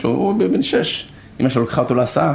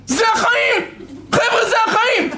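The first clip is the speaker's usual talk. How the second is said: mock parent shout. Parent yelling at complaining children, high laughing shout. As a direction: bellow it at the whining kids.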